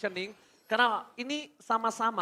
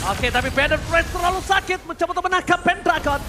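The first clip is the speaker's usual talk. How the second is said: excited; 6 semitones higher, 7 dB louder than usual. Real, not acted. Caster calling a fight.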